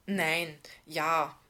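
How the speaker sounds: halting, flustered answer; mildly stammered indecision; stammering. A narrator's reading. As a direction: waver between words, then give in. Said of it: "stammelte Lisa".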